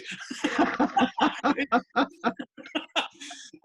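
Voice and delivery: a real burst of high laughter; laughing hard at his own punchline; big high laugh. Real, not acted. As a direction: let the laugh break out high.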